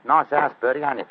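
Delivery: a touch of Cockney, chatty; casual Cockney workman; Cockney accent. Acted. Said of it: "My Cockney accent".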